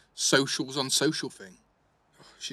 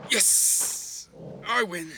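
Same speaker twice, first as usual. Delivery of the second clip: triumphant cheer; 8 semitones higher, 4 dB louder, much slower than usual. Acted. Winning the sit-up count.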